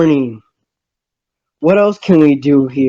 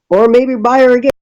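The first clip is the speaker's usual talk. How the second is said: quick, much higher burst of excitement; sudden eager idea; 9 semitones higher, faster than usual. Real, not acted. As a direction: blurt the idea out fast and high. Sudden bright afterthought about Penny.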